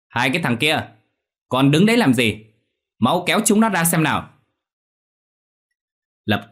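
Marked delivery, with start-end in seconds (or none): 0.1-4.3 high, loud impatient order; angry command. Acted. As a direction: shout at the idle servants. Moneylender orders servants to intervene.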